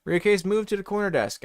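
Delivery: normal talking voice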